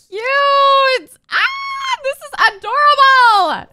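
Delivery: high, loud, drawn-out gushing; obsessed squealing. Real, not acted. Player obsessed with Sim's selfies, excited squealing. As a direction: shriek with delight, then gush. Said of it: "I'm actually obsessed".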